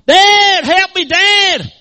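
high, loud, drawn-out cry for help; desperate hollering. Acted. Boy hanging off the cliff to his dad, hollering. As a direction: holler at full force for rescue. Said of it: "he starts hollering".

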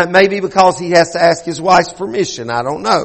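ordinary talk, nothing marked

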